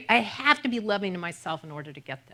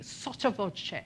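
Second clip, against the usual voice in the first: softer, under your voice; sotto voce; 12 dB quieter, slower than usual. Shown as a demonstration. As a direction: drop under your breath for the term. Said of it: "So you could go under your voice".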